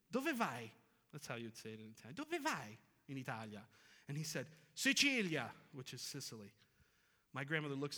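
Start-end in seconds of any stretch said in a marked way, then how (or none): 0.1-0.7 grandmother's Italian, pitched up; thick Italian accent. Retold or imitated. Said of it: "My grandmother's got a thick Italian accent". 2.1-3.6 Italian question, a little lower and softer; lightly voiced Italian grandmother; thick Italian accent. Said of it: "My grandmother's got a thick Italian accent". 4.7-5.5 Italian stallholder's answer, pitched up; thick Italian accent. Retold or imitated. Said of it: "He's got a thick Italian accent".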